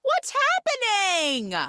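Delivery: high, drawn-out shocked wail; Dame Judi Dench cat. Retold or imitated. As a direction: cry out in hammed-up alarm. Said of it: "Dame Judi Dench cat is shocked".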